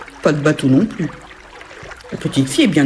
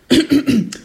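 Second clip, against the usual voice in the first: hasty rattling throat clear; 8 semitones higher than usual. Acted. Ogress fixing her disguise, throat clearing. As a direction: clear your throat before faking a voice.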